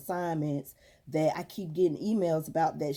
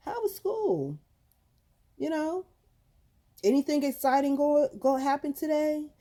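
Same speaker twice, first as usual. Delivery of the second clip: lightly acted mom quizzing her kid, higher pitch; retold daily check-in, pitched up and questioning; interested parent; 6 semitones higher than usual. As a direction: ask as a parent coaxing out news. Acts out asking daughter about school.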